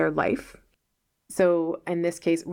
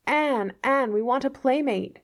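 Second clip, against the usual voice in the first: higher, sing-song call from the trees; calling voices of nature; 7 semitones higher than usual. Acted. Anne imitating the trees and flowers calling to her. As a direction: lure her out like playful spirits. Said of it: "Everything seems to be calling to me".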